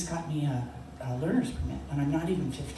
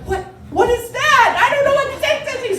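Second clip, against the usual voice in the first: very high, loud and flustered; panicked shriek; 11 dB louder than usual. Retold or imitated. Learner driver to dad, baffled, panicked shriek. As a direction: shriek back in confused panic.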